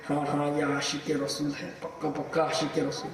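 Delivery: quietly voiced nods of approval; approving listeners. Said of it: "تو لوگ کہیں گے".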